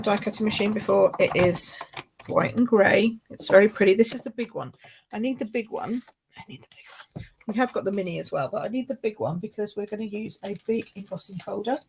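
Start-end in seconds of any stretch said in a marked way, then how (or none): 6.4-7.3 very soft whispered echo; whispered aside. Real, not acted. Crafter echoing herself about the machine, whispered. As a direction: repeat it under your breath, whispering.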